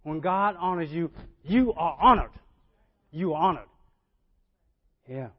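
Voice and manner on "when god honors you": usual speaking voice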